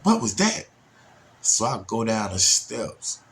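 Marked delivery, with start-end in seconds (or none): 0.0-0.7 startled question. Retold or imitated. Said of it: "I hear the bump".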